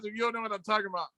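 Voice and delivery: ordinary talk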